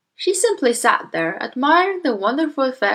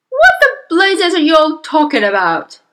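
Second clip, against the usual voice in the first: loud, raised-pitch outburst of indignation; yelling father; 7 semitones higher, 8 dB louder than usual. Acted. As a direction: yell it at the whole family. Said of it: "the father yelled".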